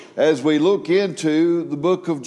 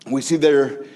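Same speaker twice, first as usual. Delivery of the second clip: ordinary talk, nothing marked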